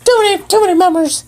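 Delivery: high fast bird voice; squeaky bird voice. Acted. Bird PD refuses help, high fast squeaky voice. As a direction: chirp it out fast.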